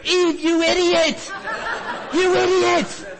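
very high, loud mock scolding; imitated outraged yelling. Villagers yelling at Eve during the skit, imitated outraged yell. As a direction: yell it shrieking high, like a heckler at a play. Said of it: "they're yelling at her".

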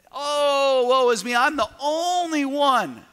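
high, loud, slow whining lament; mock a sorry-for-himself prophet; whiny self-pity. Pastor mocking Elijah's pity party with a loud whine. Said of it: "the pity in his voice".

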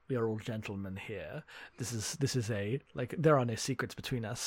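a hint of a smooth schemer, murmured low; suave gentleman. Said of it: "Mayo's trying to do the whole".

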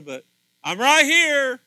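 high, loud, slow call; screaming. Acted. Holy Spirit calling out to the congregation, loud and high. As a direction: yell it across a distance. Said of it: "he's screaming".

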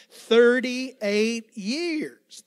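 thirty-eight years dragged out loud and slow; loud, slow repeat. Shown as a demonstration. Preacher leading the congregation's repeat, loud and slow. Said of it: "Can you say it one more time".

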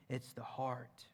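whispered emphasis; soft whispered point. Real, not acted. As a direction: breathe it quietly. Intimate emphasis in sermon.

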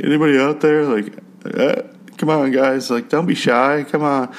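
lightly hammed-up, coaxing call for anyone out there; mock desperate caller. Desperate stranger calling out on the radio, mock pleading.

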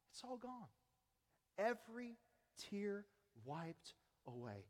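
tender fading reassurance; hushed comfort. Real, not acted. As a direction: let it fade gently. Soft consoling echo.

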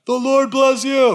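high, loud crowd reply; hearty yelled response in unison style; yelling back. Acted. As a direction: shout it back across the field. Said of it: "the whole field yells back".

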